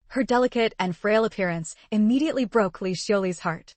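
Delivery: usual speaking voice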